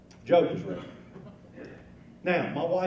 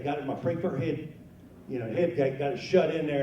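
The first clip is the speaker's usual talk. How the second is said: normal talking voice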